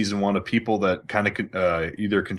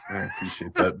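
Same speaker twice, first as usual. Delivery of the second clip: squeaky laughing thanks; high-pitched laughing thank-you; laughing sarcastic thanks; 4 dB quieter than usual. Real, not acted. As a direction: thank him while cracking up. Mock thanks after text.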